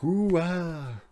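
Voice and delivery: high, loud mock gasp of wonder; amazed crowd ooh. Audience wowed by the dove trick, high amazed ooh.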